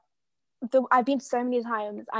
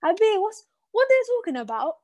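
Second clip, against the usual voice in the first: higher, louder call across the pool; a child calling for her dad across the pool, higher and louder; 6 semitones higher, 4 dB louder than usual. Retold or imitated. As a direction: call out to your dad, worried. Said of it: "I'm calling my dad".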